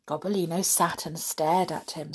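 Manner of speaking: plain reading voice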